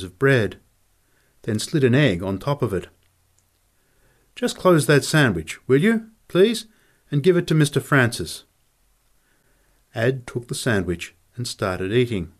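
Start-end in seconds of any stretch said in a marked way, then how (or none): 4.3-8.4 lightly voiced polite cook, lifted pitch; a touch higher, asking politely; courteous cook. Cook asks for a sandwich passed to Mister Francis, lightly voiced courtesy.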